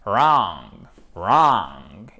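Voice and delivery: "Wrong" low and drawn out from a clean R; English R demo. Shown as a demonstration. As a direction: model "wrong" slowly, low, R first. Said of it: "Ça commence par le R". (« wrong » grave et allongé, R anglais)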